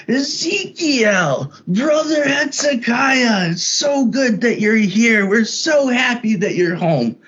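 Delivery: high, slow, otherworldly voice calling out; welcoming spirit voice. Retold or imitated. Retelling a trip, imitating the voice greeting him as brother. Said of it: "and it's saying".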